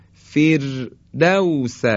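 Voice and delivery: فردوس slowly, with a thin R; light R. Shown as a demonstration. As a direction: recite فردوس drawn out, R light. (فردوس کشیده با «ر» نازک)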